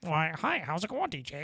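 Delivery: hammed-up chipper square; cartoon character. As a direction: greet him like a corny cartoon neighbor. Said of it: "That sounds like a cartoon character".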